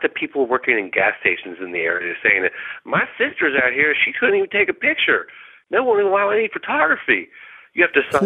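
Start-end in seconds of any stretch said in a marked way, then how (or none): 2.8-8.3 high-pitched, incredulous quote; agitated retold complaint. Caller quoting gas station workers about the ban, agitated. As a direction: pitch way up, protesting on their behalf. Retelling locals' indignant complaints.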